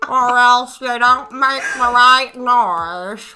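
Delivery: slow, loud, dead-fish mouth drawl; hammed-up slow loud parody of a politician; mocking politician voice. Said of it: "He talks like this".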